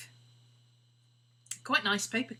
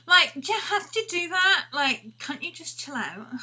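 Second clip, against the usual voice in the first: put-on high, loud Valley Girl whine; 10 semitones higher, 9 dB louder than usual. Dog Maddie as a human telling Scooby off, Valley Girl whine. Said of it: "twirling her hair in her fingers and going".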